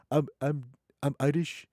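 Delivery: put-on African accent, quoting a woman; heavy African accent. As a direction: imitate her thick African accent. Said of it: "with that heavy African accent".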